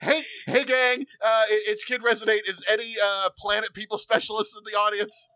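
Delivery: high, stammering call to the crowd; nervous announcer. Acted. Hero calls to audience.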